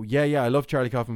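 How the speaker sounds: ordinary talk, nothing marked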